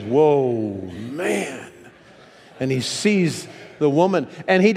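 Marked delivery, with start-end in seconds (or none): 0.0-1.7 drawn-out mock amazement; amazed "whoa, man". Shown as a demonstration. Preacher shows what woman means, amazed and drawn out. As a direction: stretch both words in wonder. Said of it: "That's what it means".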